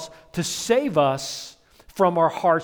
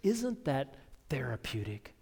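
put-on slow, soft breathy whisper of an odd old man; whispered quirky old host; 9 dB quieter, slower than usual. Old host whispering to guest about tearing bread. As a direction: hush it like sharing a secret. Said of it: "a unique accent and a unique way of talking".

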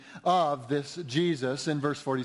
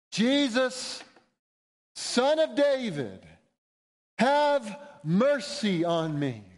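high, drawn-out cry for mercy; acted scream for help, pitched high; desperate cry; 5 semitones higher, slower than usual. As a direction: call out desperately over a crowd. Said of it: "he screams".

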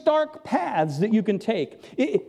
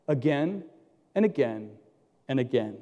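each again softer and lower; again, and again, dropping softer each time; fading repetition; 8 semitones lower, 4 dB quieter than usual. Acted. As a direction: let it trail away with each again.

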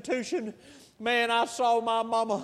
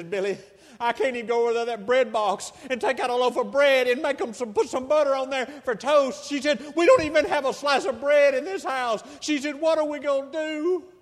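louder, higher pleading, climbing toward panic; beg, more frantic with each line; desperate plea; 6 semitones higher, 8 dB louder than usual. Retold or imitated. Mother's rising desperate plea.

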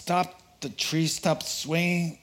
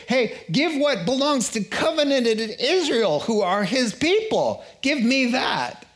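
entitled demand; 8 semitones higher than usual. Retold or imitated. Entitled sinner demanding blessings from God, pushy. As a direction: act like a sinner claiming what isn't his.

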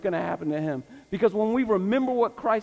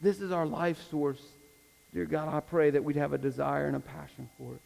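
public prayer. Real, not acted. Pastor asks God to grow the congregation, earnest closing prayer. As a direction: pray it out phrase by phrase, with long pauses.

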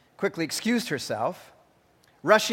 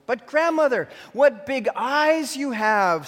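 wondering Red; 8 semitones higher, 4 dB louder, slower than usual. A narrator's reading. Red questions disguised wolf.

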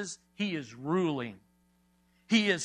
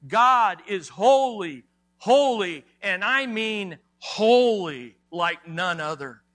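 each "holy" punched out loud; emphatic repetition; 6 dB louder than usual. Shown as a demonstration. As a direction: hammer every "holy" as its own loud, falling beat. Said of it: "three times for emphasis".

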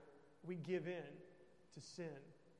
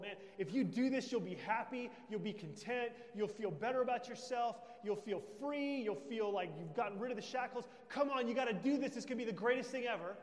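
hyped-up, louder, higher-pitched sales push; pumped-up persuasion, raised and urgent; excited tempter; 5 semitones higher, 7 dB louder than usual. Acted. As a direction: egg them on with big eager promises. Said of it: "He promises you the world".

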